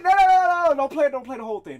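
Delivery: loud, high no-no-no; panicked shout. Real, not acted. Stopping the song playing, panicked loud shout. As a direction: yell to stop it fast.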